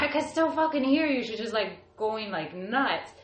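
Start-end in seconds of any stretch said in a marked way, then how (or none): 0.0-1.2 higher-pitched mock demon taunt; put-on voice of the possessed, pitched up; retold angry outburst. Possessed sister to her praying family, retold angry outburst.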